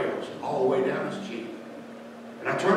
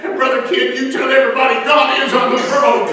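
building louder and higher to a full shout; preaching at full force, ever louder; preaching climax; 6 semitones higher, 8 dB louder than usual. Acted. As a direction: rise into the altar-call peak. Sermon climax, God on throne.

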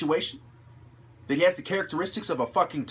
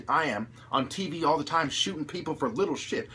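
usual speaking voice

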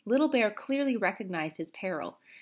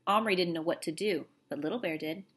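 ordinary delivery, nothing marked

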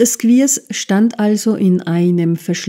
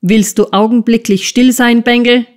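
angry schoolmaster yell, higher and louder; yelling at boy; 5 semitones higher, 5 dB louder than usual. A narrator's reading. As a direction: bark it at the crying child. (Lehrer brüllt den weinenden Jungen an)

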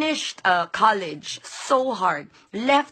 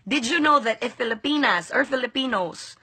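normal talking voice

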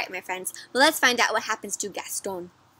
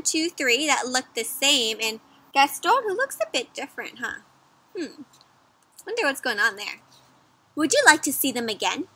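plain reading voice

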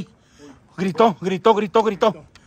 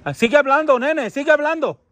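calling out; 7 semitones higher than usual. Real, not acted. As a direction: call across a distance. Calling to a lost child spirit. (llamando a un niño perdido, en voz alta)

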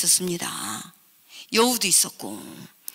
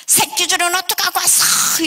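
very high, loud scolding; angry shrieked demand; 11 semitones higher, 6 dB louder than usual. Retold or imitated. Stepmother confronting father over the rope, shrieking. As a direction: shriek it like an accusation.

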